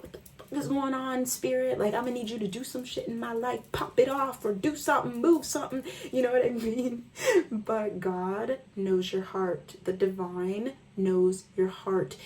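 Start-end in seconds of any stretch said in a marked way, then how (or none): 0.0-5.8 high, fast, exasperated demand; cussing at spirit. Retold or imitated. Talking to her spirit team, high exasperated demand. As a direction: demand action from spirit, half joking. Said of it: "I'm like".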